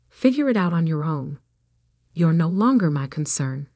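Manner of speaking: plain reading voice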